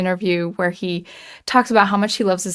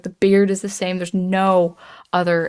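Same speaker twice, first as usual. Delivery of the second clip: usual speaking voice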